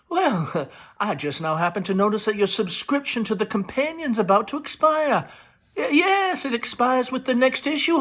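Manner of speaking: high, bright-pitched Southern society chatter into the phone; Amanda on the phone. A narrator's reading. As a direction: gush to an old friend on the telephone. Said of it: "Amanda enters with the telephone".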